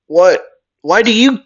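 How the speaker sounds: high, loud outburst; indignant yell. Acted. Bakugo challenging Midoriya over the license, yelling. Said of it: "Bakugo would yell".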